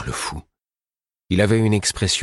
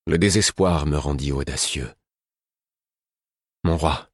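plain reading voice

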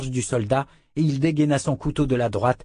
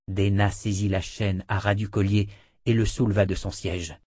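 plain reading voice

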